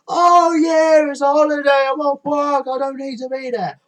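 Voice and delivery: high, loud mock-carefree voice; hammed-up carefree beachgoer shouting; mocking careless people. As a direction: parody the holidaymakers shouting. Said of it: "because they think".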